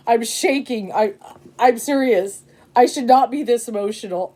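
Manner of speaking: normal talking voice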